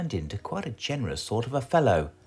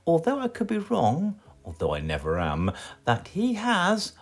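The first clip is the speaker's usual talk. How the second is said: smug wizard; 2 semitones higher than usual. Acted. Wizard talks to himself, smugly satisfied. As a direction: muse smugly to yourself.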